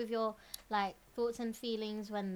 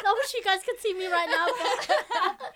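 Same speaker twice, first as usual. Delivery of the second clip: high, loud laughter through the words; laughing; 11 semitones higher, 9 dB louder, slower than usual. Real, not acted. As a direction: crack up mid-sentence.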